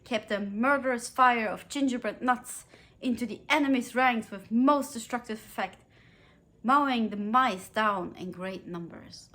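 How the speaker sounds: lively storybook narration of a toy battle; animated story reading. Acted. Vlogger reads funny Nutcracker battle passage to viewers, animated storytelling. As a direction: read the battle scene with gusto, like to children.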